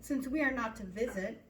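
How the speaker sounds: ordinary talk, nothing marked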